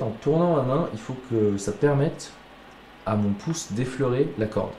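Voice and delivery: ordinary talk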